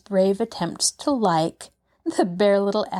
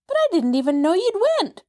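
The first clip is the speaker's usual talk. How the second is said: much higher, fast and louder outcry; cried out; 11 semitones higher, 4 dB louder, faster than usual. Acted. Maid scolding the runaway girl, crying out. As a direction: blurt it in alarm. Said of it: "cried Nancy".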